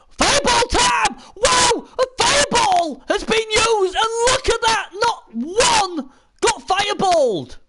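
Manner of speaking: very high, loud play-by-play outburst; excited commentary shout. Real, not acted. Commentator calls the fireball being used, excited shout. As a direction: yell the big spell as it happens.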